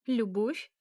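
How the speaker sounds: bold question. A narrator's reading. Elya challenges the vampire about love, boldly. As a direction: ask it boldly, pitch lifting at the end. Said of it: "смело спросила Эля".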